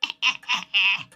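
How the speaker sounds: loud laugh. Real, not acted.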